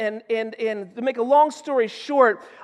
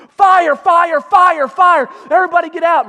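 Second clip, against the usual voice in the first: high, loud desperate alarm; screaming at top of lungs; 8 semitones higher, 9 dB louder than usual. Retold or imitated. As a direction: shout to get everyone out of the house. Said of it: "screaming at the top of my lungs".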